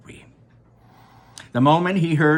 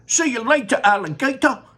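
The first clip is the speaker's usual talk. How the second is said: high, gleeful taunt; cheeky shout; 12 semitones higher, 5 dB louder than usual. Acted. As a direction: toss it back as he goes. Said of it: "he shouted".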